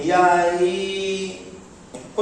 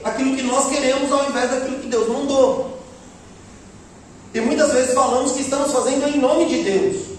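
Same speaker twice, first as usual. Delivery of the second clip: rising preaching; 4 semitones higher than usual. Real, not acted. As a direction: build the sermon to a climax. Preaching builds up. (pregação em tom crescente)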